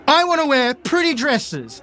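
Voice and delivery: put-on very high, slow whiny child voice; petulant young girl. Young Mary defying her fencing teacher before storming off, petulant protest. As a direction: wail it like a stubborn little girl. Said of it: "And she goes".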